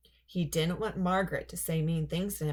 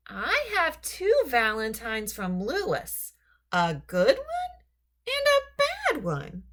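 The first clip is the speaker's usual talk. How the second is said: put-on high, slow, louder whiny girl; complaining girl; 6 semitones higher, 5 dB louder, slower than usual. Margaret accusing Lewis over her valentines, whiny and loud. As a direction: drag out the grievance accusingly.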